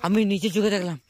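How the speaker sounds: high, urgent and agitated; breathless eyewitness. Real, not acted. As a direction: blurt out what you just saw. Just saw something, telling friend.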